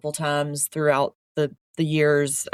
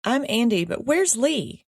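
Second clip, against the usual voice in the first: put-on Southern accent; 7 semitones higher than usual. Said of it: "you don't have to do a Southern accent this episode".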